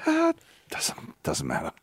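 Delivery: hushed, deflated near-whisper. Retold or imitated. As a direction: barely breathe the words out. Nick's deflated reply in story.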